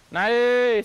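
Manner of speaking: loud, high call to the apes, stretched out; drawn-out shout to the siamang. Real, not acted. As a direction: send them back up the hill with a long call. Keeper calling apes to climb.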